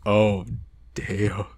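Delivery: ordinary talk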